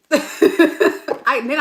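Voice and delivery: laughing through the words, fast. Real, not acted. Telling viewers about her attitude, laughing. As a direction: laugh as you admit it. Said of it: "I get an attitude".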